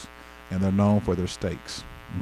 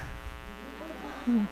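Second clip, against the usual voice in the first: silent airy laugh; breathless unvoiced laugh; breathy laugh; 12 semitones higher, 12 dB quieter than usual. Real, not acted. Reaction to friend's reply.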